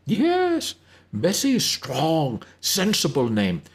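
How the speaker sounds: high, slow and emphatic, in a put-on voice; emphatic Wyan. Wyan to Elizabeth, emphatically approving the name Bessie. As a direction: insist the name is sensible, leaning on each word. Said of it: "nodding emphatically".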